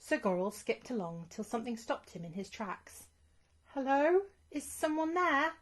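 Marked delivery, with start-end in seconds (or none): 3.7-5.5 high, louder nervous call; nervous knight calling out. Acted. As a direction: call out into the woods, unsure. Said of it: "he called".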